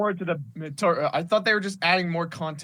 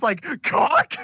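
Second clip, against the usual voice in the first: imitated sound effect; 11 semitones higher, 4 dB louder than usual.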